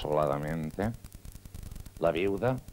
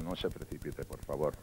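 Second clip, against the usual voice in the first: oculista suave; 5 semitones lower, 6 dB quieter than usual. Acted. Oculista calma al paciente.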